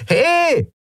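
very high yelled hail; calling out. A narrator's reading. Pirate woman at the door hailing her crew, calling out. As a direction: yell for their attention in one high cry. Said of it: "rief die klapprige Frau an der Tür wieder".

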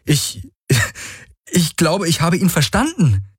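high, halting start, then astonished; falter, then realize it in wonder; high-voiced dawning amazement; amazed realization. A narrator's reading. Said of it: "Plötzlich lachte sie verblüfft".

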